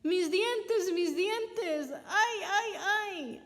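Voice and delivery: gimoteo agudo y alto; aúlla por los dientes rotos; aullido de dolor. Acted. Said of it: "aulló Coyote". (Coyote aúlla de dolor)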